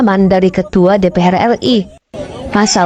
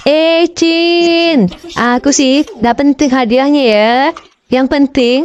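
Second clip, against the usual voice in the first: higher, sing-song camp drawl, dragged out at the end; camp banci voice; 6 semitones higher, slower than usual. Acted. The banci answering the angry crowd from the pole, camp and relaxed. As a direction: purr it lazily, savouring it.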